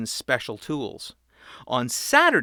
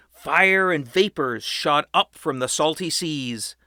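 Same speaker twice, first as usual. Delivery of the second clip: plain reading voice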